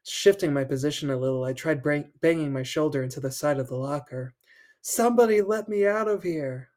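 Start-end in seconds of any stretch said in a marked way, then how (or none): 4.8-6.7 higher pleading shout; trapped boy begging to be let out; desperate shout. Acted. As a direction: call for anybody to hear you. Trapped in locker, crying out.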